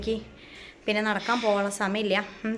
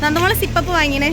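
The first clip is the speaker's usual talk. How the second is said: raised voice over traffic; louder; 5 semitones higher, 5 dB louder than usual. Real, not acted. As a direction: say it louder over the passing car. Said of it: "car വന്നോട്ടെ".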